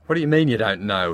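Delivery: loud, harsh and accusing; angry growl. Acted. Husband growling at wife over dirty glasses, angry. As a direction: snarl the question at her. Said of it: "he growled".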